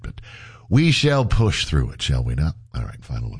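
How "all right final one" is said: ordinary talk, nothing marked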